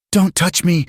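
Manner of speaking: high, sharp scream of panic. A narrator's reading. Alina screams as Eli touches her shoulder. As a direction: shriek at him to back off. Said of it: "she screamed".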